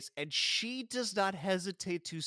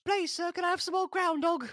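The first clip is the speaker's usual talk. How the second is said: very high, pleading little orphan voice; Oliver Twist plea; 14 semitones higher, 5 dB louder than usual. Pretend. Co-host joining the Dickens bit as a pleading orphan, high voice. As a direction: beg sweetly like a starving orphan.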